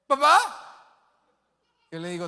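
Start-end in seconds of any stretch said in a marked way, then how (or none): 0.1-0.4 put-on higher, louder, rising child's call; child calling dad again. Little son calling louder for his dad, rising at the end. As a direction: call again, more urgent, voice climbing.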